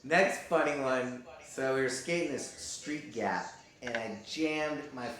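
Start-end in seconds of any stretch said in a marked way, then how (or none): none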